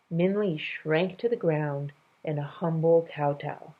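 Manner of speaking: plain reading voice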